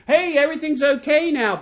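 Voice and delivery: high, loud, breezy all-clear; mock cheery reassurance. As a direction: sing out false good news, pitch up. Mimics the runner's false all-clear.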